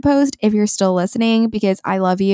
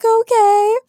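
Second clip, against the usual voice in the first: much higher, louder squeaky okay; meek little okay; 13 semitones higher, 4 dB louder than usual. Retold or imitated. Answering the coach, meek high squeak. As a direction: squeak it out, defeated.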